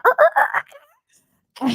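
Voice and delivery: very high, squeaky monkey hoots; high-pitched mock monkey cry; monkey noise. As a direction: screech out a silly monkey call. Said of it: "Make a monkey noise".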